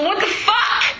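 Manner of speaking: high, laughing what the fuck; shocked outburst. Retold or imitated. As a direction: blurt it out in disbelief. Shocked reaction to friend.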